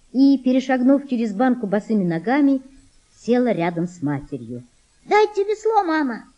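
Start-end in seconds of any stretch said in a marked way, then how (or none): 5.1-6.2 high-pitched, mildly angry demand; cross little girl. A narrator's reading. Girl demands the oar from mother, cross. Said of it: "сердито сказала она".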